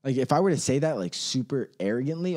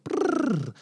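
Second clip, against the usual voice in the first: shiver sound; 10 semitones higher than usual. Acted. Shiver at creepy imagery.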